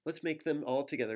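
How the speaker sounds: ordinary talk, nothing marked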